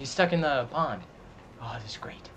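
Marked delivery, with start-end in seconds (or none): 1.6-2.3 hushed whisper, barely voiced. Real, not acted. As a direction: whisper so the dino won't hear. Whispering while sneaking near dino.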